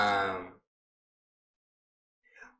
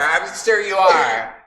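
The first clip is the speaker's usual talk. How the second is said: raised voice cutting in; louder, talking over her; speaking up over her; 5 semitones higher, 5 dB louder than usual. Pretend. A student talking over her rival, raised voice. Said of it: "speaks up over her".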